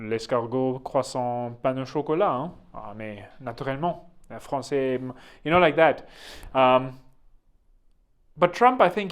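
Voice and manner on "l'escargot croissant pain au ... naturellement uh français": mock French patter with French accent; lightly hammed-up French speaker; Macron. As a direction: mumble French words in a loose French accent. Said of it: "when Macron talks".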